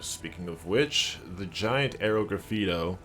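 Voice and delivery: usual speaking voice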